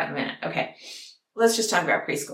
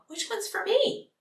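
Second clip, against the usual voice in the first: high, slower imitation of a child's question; little children; 11 semitones higher, 4 dB quieter, slower than usual. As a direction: ask it as a small, hopeful child. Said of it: "those little children are gonna go".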